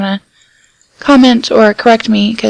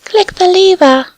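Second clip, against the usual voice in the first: squeaky, much higher, slow; 8 semitones higher, slower than usual. Pretend. Reminding the friend about the lever, squeaky voice. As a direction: squeak it out like a cartoon. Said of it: "Why'd you go squeaky".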